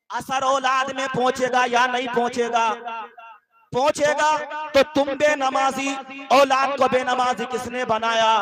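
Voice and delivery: loud, ringing sermon delivery; forceful preaching. Acted. Preacher urging the congregation to choose godly company, forceful preaching. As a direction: preach it out to the whole gathering.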